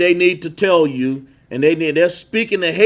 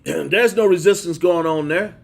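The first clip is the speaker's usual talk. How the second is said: normal talking voice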